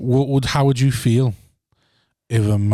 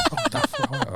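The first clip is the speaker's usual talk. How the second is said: giggled high and breathy; laughing mock line; 7 dB quieter, slower than usual.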